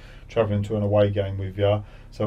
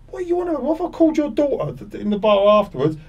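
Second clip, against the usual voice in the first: much higher, louder exasperated challenge; incredulous confrontation; 5 dB louder, faster than usual. Retold or imitated. Player confronting the heckler in the bar, high exasperated challenge. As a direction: demand an answer, amazed.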